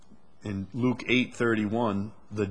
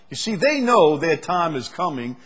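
high, loud preacher's build; fiery sermon delivery; preaching at full force; 6 semitones higher, 5 dB louder than usual. Acted. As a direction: drive the point home from the pulpit.

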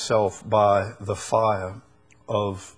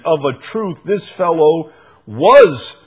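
raised, insistent assertion; confidently affirming; 4 semitones higher, 7 dB louder than usual. Acted. Another bystander identifies Peter, confidently affirming. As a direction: declare it with loud certainty. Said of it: "another confidently affirmed".

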